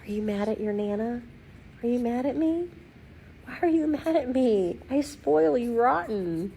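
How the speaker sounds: put-on high, wheedling baby-talk to a dog; coaxing pet-talk. Nana asking her pug why he's mad, high coaxing voice.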